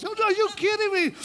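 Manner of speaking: very high mock protest; hammed-up girly denial; squealing woman. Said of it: "Woman number one says".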